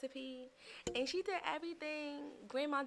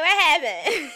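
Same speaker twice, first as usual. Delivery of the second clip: laughing mock surprise; 6 semitones higher, 21 dB louder, slower than usual. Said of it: "you know, like".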